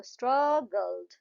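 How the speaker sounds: स्ट्रगल्ड slowly in two parts, स्ट्रग, गल्ड; syllable by syllable. Shown as a demonstration. Teacher recaps two sounds in स्ट्रगल्ड, syllable by syllable. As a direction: draw out each syllable of स्ट्रगल्ड. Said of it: "पहले वाले में यू का आया".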